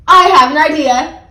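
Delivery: loud, high burst of inspiration; bright idea called out loudly; excited announcement. A narrator's reading. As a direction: proclaim it as a little hero. Child announces her plan.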